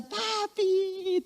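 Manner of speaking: put-on high, soft little-kid "Papi"; child's greeting. Small child greeting dad coming home, high and soft. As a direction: coo it like a toddler.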